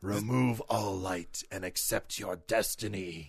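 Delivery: slow, low villain voice; low haunting voice. Pretend. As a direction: tempt him slowly toward the dark. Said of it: "only evil people say that".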